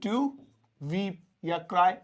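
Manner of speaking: very slow, drawn out teaching read; slow dictation. Shown as a demonstration. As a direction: spell it out slowly for the class. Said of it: "तो वह रोने लगती है".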